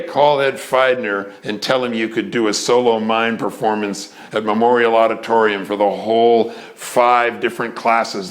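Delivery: ordinary talk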